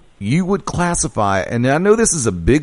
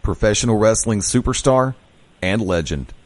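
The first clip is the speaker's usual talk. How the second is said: deep, measured radio host sign-off voice; radio announcer; 5 semitones lower than usual. Acted. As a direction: announce the guest like a show host, then push to the break. Host signs off to break.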